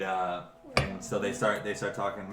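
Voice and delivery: normal talking voice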